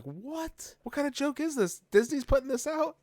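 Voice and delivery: incredulous disbelief. Retold or imitated. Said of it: "I was like".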